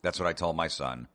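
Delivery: ordinary delivery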